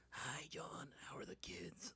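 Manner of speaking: hissing whisper; whispered lizard voice. Pretend. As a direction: breathe it like a creepy lizard. Lizard neighbor speaking.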